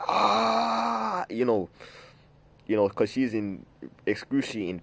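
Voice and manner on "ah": long, high mock scream; imitated labor scream. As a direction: mimic a woman screaming in labor, one sustained high "ah". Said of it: "screaming, she's like".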